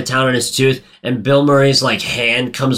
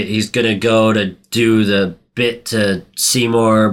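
ordinary delivery

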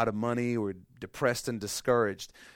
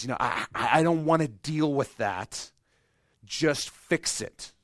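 louder, higher, insistent just fix it; pleading demand; 5 semitones higher, 6 dB louder than usual. Pretend. Believer pleading loudly with God to just fix it. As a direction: press God hard to fix it.

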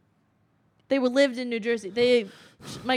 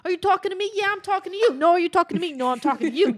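higher, louder tough-guy back-and-forth; mock mobster quarrel; 6 semitones higher, 4 dB louder, much faster than usual. Mocking the movie's shouting matches, hammed-up tough guy. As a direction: parody a mobster squaring up. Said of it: "half the movie is them going".

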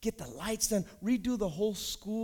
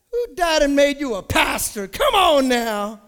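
loud, higher, incredulous shout; mock outrage; 6 semitones higher, 13 dB louder, slower than usual. Real, not acted. Preacher roasting his friend's advice, loud mock outrage. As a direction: blast the question at the room.

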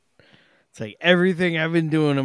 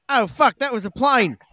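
put-on Australian accent; 12 semitones higher, 4 dB louder than usual. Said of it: "in an Australian accent".

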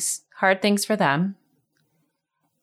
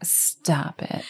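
whispering; 6 dB quieter than usual. Real, not acted. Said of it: "mock shock whisper".

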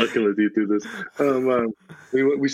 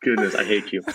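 normal talking voice

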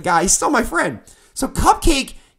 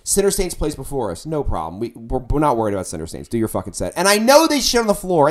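usual speaking voice

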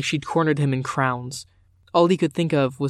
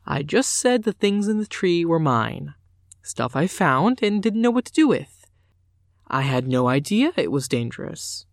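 bright, breezy innocence, pitched up; a daughter lying lightly to her father, falsely breezy; false lightness; 4 semitones higher than usual. A narrator's reading. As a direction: play innocent with a light, carefree lilt. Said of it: "false lightness in her voice".

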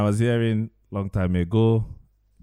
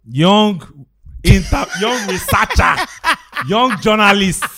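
laughing; 9 semitones higher, 3 dB louder, slower than usual. Real, not acted. Teasing praise while laughing.

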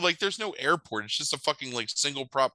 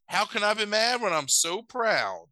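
high, gushing mock pride; imitated proud father; 9 semitones higher than usual. Dad to winning son, hammed-up pride. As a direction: overdo a dad's pride.

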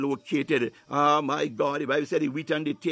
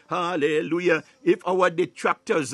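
ordinary delivery

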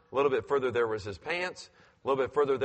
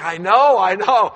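calling back; 7 semitones higher, 12 dB louder than usual. Retold or imitated.

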